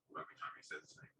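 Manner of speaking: ordinary delivery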